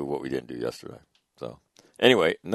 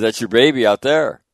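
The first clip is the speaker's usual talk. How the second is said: excited; 6 semitones higher, 10 dB louder than usual. Retold or imitated. Pointing out son's ship to cousin.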